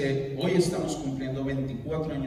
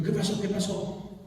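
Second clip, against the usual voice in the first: higher-pitched worried question; 6 semitones higher than usual. Retold or imitated. Husband phoning home for news, worried. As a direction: re-enact an anxious, repeated demand. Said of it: "Yo estaba preocupado".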